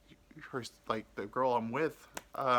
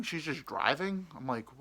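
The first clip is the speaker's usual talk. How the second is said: normal talking voice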